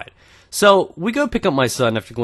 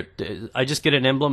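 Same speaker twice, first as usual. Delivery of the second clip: usual speaking voice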